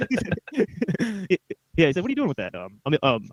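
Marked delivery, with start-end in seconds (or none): 2.0-2.5 lightly imitated Boston accent, pitched higher; touch of high-pitched Boston mimicry. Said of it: "So that's how he talks".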